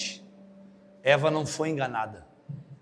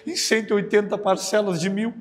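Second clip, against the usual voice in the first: normal talking voice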